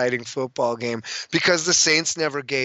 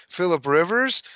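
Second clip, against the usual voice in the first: usual speaking voice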